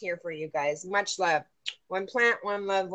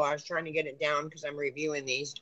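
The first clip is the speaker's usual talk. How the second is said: usual speaking voice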